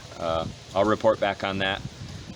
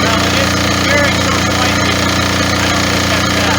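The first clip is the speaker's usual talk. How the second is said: raised, high voice over a running motor; shouting over engine; 13 semitones higher, 8 dB louder than usual. Real, not acted. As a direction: project over loud machinery. Narrating beside a running generator.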